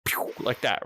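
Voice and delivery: breathy pew of a ship zooming off; whispered pew sound. Retold or imitated. Describing the ship's takeoff to friends, a whispered pew. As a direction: mimic the ship shooting away.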